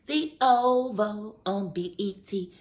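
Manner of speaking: announcer read. Shown as a demonstration.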